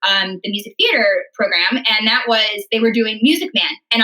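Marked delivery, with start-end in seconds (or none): none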